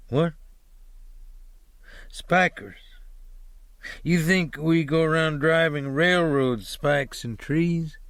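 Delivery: lightly pointed questions with a falling Spikers; challenging driver. Acted. Driver pressing the hitchhiker about tree spiking, mildly defensive.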